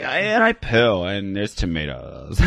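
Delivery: slow, fading mock snooty drawl; lightly voiced uppity chick; uppity vegan voice. Comic on the show mocking vegans, slow fading snooty voice. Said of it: "it's more like".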